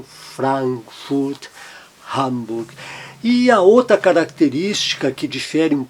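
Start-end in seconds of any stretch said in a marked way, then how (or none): 0.0-2.7 Frankfurt, Hamburg, the A kept wide open; open A demo. Shown as a demonstration. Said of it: "mantém o som bem aberto". (A aberto em Frankfurt, Hamburg)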